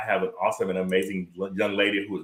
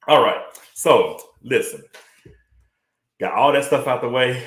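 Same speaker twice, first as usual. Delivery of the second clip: breathless, slightly louder talk; out of breath; 3 semitones higher, 6 dB louder than usual. Real, not acted. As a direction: speak while catching your breath. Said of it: "I'm out of breath already".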